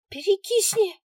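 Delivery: put-on very high, loud little girl; shrill child retort. A narrator's reading. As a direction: fling it like a bratty kid. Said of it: "кинула девчонка".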